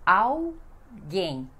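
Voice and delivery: "Alguém" slowly as au... GAYN; Portuguese pronunciation. Shown as a demonstration. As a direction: split "Alguém" in two, L like U, stress on GUÉM. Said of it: "sílaba mais forte é gen".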